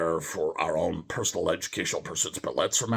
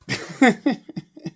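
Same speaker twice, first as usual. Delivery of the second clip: high laugh; 6 dB louder than usual. Real, not acted. Laughs at own mistake.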